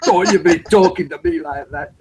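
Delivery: imitated Scotsman telling someone off; put-on Scottish insults; mock Scottish scolding. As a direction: play an offended Scot, breaking into laughter. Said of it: "And then he goes".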